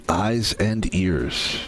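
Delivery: slow, drawn-out reminder. Real, not acted. Streamer warns viewers, very slow drawn-out reminder. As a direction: let each word of Eyes and ears hang slowly.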